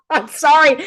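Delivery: high-pitched giggly sorry; laughing apology. Real, not acted. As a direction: burst out laughing, then apologise. Laughs at own sarcasm.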